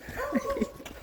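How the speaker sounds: high quiet laugh; soft giggle; breathy giggle. Real, not acted. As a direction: giggle softly. Laughs after greeting.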